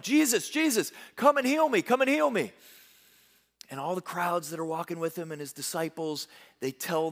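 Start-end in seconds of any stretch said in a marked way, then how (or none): 0.0-2.5 high pleading call, calling out to Jesus; retold yell. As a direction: cry out to be healed. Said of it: "he starts yelling".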